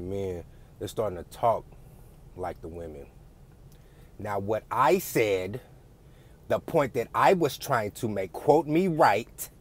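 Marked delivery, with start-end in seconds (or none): 4.2-9.5 high, loud, drawn-out mock female inflection; mocking singsong swoops, slow and raised; mimicked women's inflection. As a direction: imitate men talking like women, stretching the words. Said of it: "notice how bitches talk like that".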